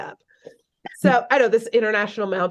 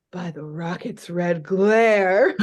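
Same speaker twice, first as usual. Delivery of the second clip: slow, put-on muttered oath; Major Glory muttering; 3 semitones lower, slower than usual. Major Glory reacting to his man losing, slow mock mutter. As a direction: draw it out as a mock-patriotic curse. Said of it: "Major Glory mutters".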